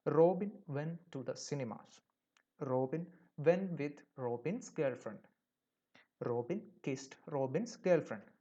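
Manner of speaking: very slow, word by word, like dictation; slow example reading. Shown as a demonstration. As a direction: spell out the example sentence slowly for the class. Teacher reads the faulty example. (പതുക്കെ വാക്കുവാക്കായി വായിക്കുന്നു)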